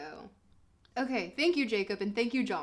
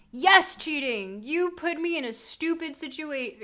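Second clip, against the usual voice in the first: defiant shout; 4 semitones higher, 6 dB louder than usual. Real, not acted. Streamer answering chat that she will cheat, shouting defiantly. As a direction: proclaim it proudly.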